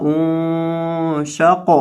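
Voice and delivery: انشق with the nun nasalized and held very slowly; ghunnah recitation. Shown as a demonstration. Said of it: "گنہ".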